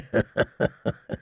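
low chuckle without voice; mocking laugh. A narrator's reading. Laughing at the wench's discomfort, mockingly. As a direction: chuckle at your own jibe. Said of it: "He laughed".

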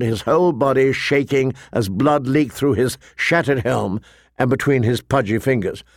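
ordinary delivery

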